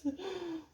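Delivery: sharp high gasp; shocked gasp. Real, not acted. Spots cat hair on machine.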